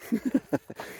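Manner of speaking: soft high chuckle; laugh. Real, not acted. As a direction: chuckle at your own silly idea. Laughs at funny bird idea.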